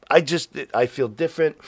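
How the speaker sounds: ordinary talk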